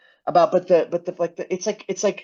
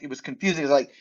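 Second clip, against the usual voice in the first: usual speaking voice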